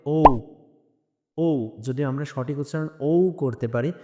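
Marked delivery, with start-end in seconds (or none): 0.1-1.8 ও drawn out, gliding toward "u"; slow, isolated model of ও; correct letter O. Shown as a demonstration. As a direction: round ও off into an "oo". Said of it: "উচ্চারণ হবে ও".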